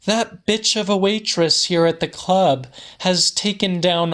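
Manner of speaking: high, put-out woman's voice well above the narrator; an indignant woman brushing off an apology; crimson woman's voice. A narrator's reading.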